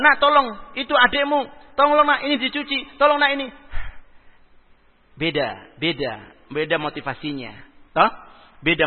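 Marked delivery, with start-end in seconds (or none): 0.0-3.5 put-on high, loud, bossy orders to the child; mother bossing her child into chores; nagging mother. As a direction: snap out chores like an irritated mother. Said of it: "beda intonasinya".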